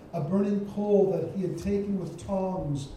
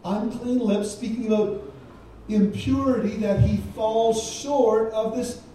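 higher and louder, pressing each phrase; forceful preaching; 5 semitones higher, 5 dB louder than usual. Acted. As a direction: preach it out to the congregation. Preaching Isaiah's confession with force.

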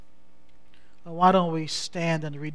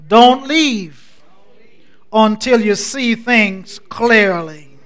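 loud, raised, driving sermon delivery; impassioned preaching; 8 semitones higher, 17 dB louder than usual. Real, not acted. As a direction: preach it out to the whole church, drawing out the refrain.

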